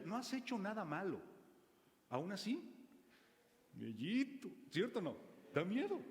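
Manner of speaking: ordinary talk, nothing marked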